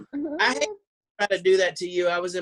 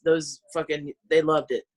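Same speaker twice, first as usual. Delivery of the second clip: normal talking voice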